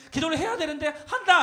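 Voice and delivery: ordinary talk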